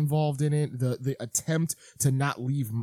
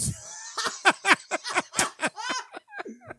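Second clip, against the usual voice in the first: 1 high wheezing laugh. Real, not acted. Big laugh at accent joke.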